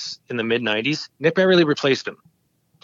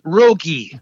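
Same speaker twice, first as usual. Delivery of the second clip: put-on louder, higher French-accented name; heavy French accent; 3 semitones higher, 6 dB louder than usual. French caller repeating his name on request, heavy French accent. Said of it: "very heavy French accent".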